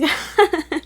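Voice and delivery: high, loud real laugh; sudden amused laugh; burst of laughter. Real, not acted. Amused at own card reading.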